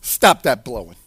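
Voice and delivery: low-pitched, contained command; rebuking. Acted. Jesus commanding the wind to stop, low-pitched rebuke. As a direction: order it down without raising the voice. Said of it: "he rebuked the wind".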